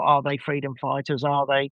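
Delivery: normal talking voice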